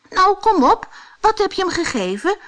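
high, loud, quick prodding; urgent storybook demand; impatient urging. Acted. Impatient hero presses the spider.

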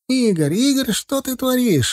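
acted panic in a high voice; panicked yell. A narrator's reading. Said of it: "заорал Титок не своим голосом".

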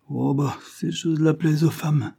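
a hint of a sneer. A narrator's reading. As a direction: scoff at her, gently mocking. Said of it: "s'écria Afy en ricanant".

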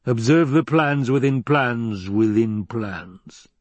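slow, drawn-out villain voice; scheming uncle, very slow and deliberate; scheming Baron. Acted. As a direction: savour each word slowly like a plotter. Baron savours his scheme, slow.

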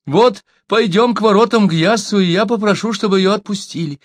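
high, eager flattery; storyteller voicing a wheedling coward; ingratiating. A narrator's reading. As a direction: plead high-voiced to win them over. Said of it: "Льстиво оглядывая толпу".

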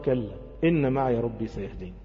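low, soft recitation; calm assurance. Acted. Moses reassures his people by the sea, calm assurance. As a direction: answer softly with settled trust.

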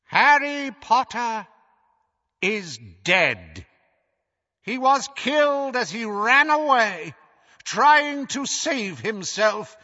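slow, high, booming villain proclamation; megalomaniac rant. Acted. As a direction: gloat over the defeated crowd, slow and loud. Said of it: "his voice magically magnified so that it swelled".

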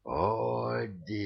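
a god's held bellow to the sky; roar. A narrator's reading. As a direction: roar the name out long. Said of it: "взревел он".

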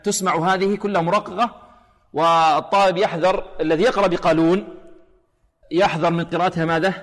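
ordinary delivery

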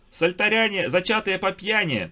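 high, loud, slow madman yell; wild unhinged ranting. A narrator's reading. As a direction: scream insults like a raving lunatic. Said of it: "заорал сумасшедший".

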